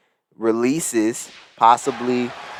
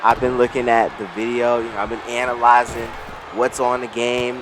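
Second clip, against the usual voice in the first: ordinary talk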